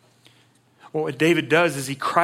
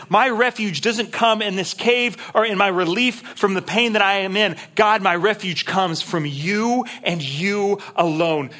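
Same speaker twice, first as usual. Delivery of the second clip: impassioned preaching; 5 semitones higher, 3 dB louder than usual. Acted. Preacher voicing David's cry.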